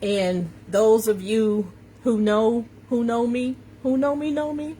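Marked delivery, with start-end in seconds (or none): none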